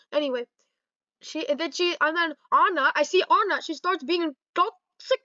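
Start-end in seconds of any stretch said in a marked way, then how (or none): none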